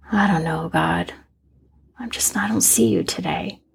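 a tired little prayer breathed out; weary whisper. Retold or imitated. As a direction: let it sink to a whisper.

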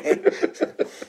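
unvoiced high laugh; airy burst of laughter; breathy high laugh. Real, not acted. Laughing at Nadja's line, high airy laugh.

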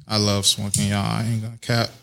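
usual speaking voice

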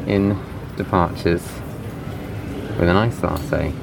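muffled behind a face mask; through a face mask. Real, not acted. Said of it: "it feels weird talking through a mask".

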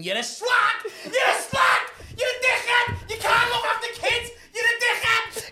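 very high, loud long-distance yell; shouting at a woman. Acted. Man yelling back from a hundred yards away, screaming. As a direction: bellow each insult separately at top pitch. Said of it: "I'm a man shouting at a woman".

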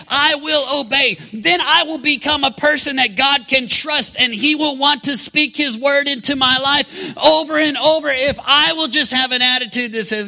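high, loud, fervent declaration; full-force preaching. Acted. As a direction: pledge it boldly from the pulpit.

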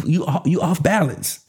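ordinary talk

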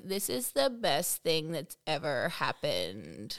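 slow, low, creaky deadpan; emotionless vocal fry. Shown as a demonstration. Showing the co-host fry has no emotion, deadpan. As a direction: drain all joy from good news. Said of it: "it has no emotion".

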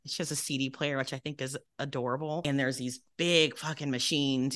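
ordinary talk, nothing marked

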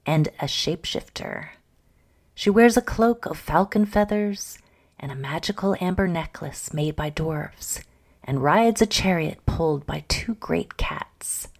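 ordinary talk, nothing marked

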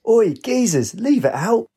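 high, indignant geezer holler; crying out. A narrator's reading. Lynx under a hail of golf balls, crying out. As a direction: yell a protest from cover. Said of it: "He cried".

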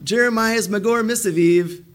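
high, slow singsong taunt; mocking. Crowd mocking Jeremiah with a nickname, taunting. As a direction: jeer the name in a drawn-out high voice. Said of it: "they're mocking Jeremiah".

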